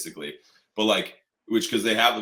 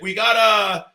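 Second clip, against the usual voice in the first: higher, unsure mock guard; 8 semitones higher, 4 dB louder, slower than usual. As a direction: check in uncertainly with a partner. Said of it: "He's like".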